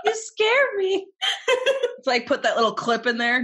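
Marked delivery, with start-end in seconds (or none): 0.0-1.5 put-on giggling through a girl voice; laughing squeaky mimic.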